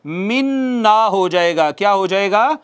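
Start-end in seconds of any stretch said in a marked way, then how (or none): 0.0-1.0 "मिन ना" as one long doubled, hummed n; इदगाम के साथ. Shown as a demonstration. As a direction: hold the merged n of "मिन ना". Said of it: "उसमें जम कर देंगे". ("मिन ना" में नून पर शद, खींचकर)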